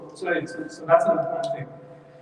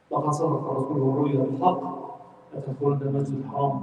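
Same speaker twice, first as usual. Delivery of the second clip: Quran recitation; 4 semitones lower, much faster than usual. Acted.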